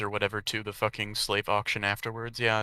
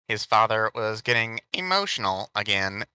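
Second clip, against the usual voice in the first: ordinary delivery, nothing marked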